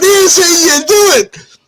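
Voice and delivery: high, proclaiming demonstrator; son's showman instruction. Retold or imitated. Son demonstrates to cheering crowd, showman instruction.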